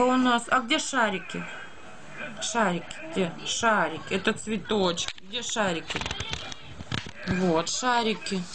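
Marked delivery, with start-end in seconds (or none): none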